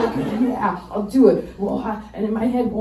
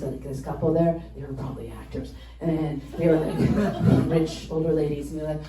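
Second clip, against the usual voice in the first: ordinary talk, nothing marked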